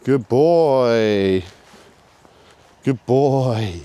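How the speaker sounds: sing-song dog praise; praising the dog. Real, not acted. Praising dog after recall.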